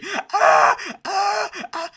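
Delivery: high, drawn-out fake cries; mock pain wails. Streamer mocking an injured quarterback, wailing in pain. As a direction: cry out in hammed-up agony.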